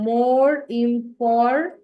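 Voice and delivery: very slow, each word drawn out; slowly spelled-out demo. Shown as a demonstration. Teacher to students: very slow model reading. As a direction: read it out slowly for the class. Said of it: "আবার কি বলি".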